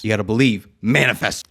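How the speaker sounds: loud pep-talk push; hyped shout. Acted. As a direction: pump them up loud.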